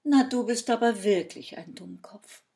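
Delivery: loud, exasperated rebuke; scolding fairy. A narrator's reading. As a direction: tell him off loudly. Fairy scolds the foolish man. (laut tadelnd)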